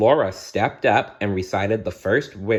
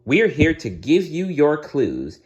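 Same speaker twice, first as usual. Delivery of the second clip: fairy voice; 7 semitones higher than usual. Acted. Fairy Merryweather speaks.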